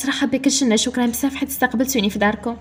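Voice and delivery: ordinary delivery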